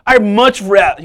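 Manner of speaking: cab driver lifting high and loud, slowing on the bright lights; dreamy cab driver. Retold or imitated. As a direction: sell the bright lights like a dream he has had for years. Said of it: "He's like".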